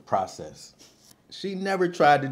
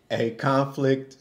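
ordinary talk, nothing marked

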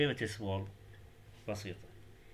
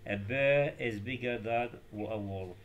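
the full answer read very slowly; slow model answer; much slower than usual. Shown as a demonstration. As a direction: say the corrected sentence carefully for copying. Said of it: "فهي bigger". (قراءة الجواب ببطء)

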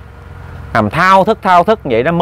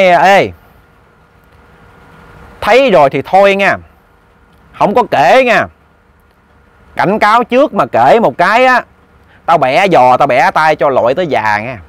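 a menacing ghost warns the man not to tell; ghost's warning; 3 semitones higher than usual. Retold or imitated. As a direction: raise the voice into a spooky ghost threatening. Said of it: "cái giọng của người âm".

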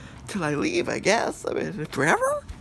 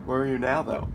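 ordinary delivery

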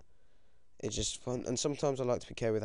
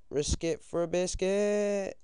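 high, drawn-out singsong question; playful dare; 9 semitones higher, 4 dB louder, slower than usual. Real, not acted. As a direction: stretch it out as a teasing dare. Playful catchphrase while considering a risk.

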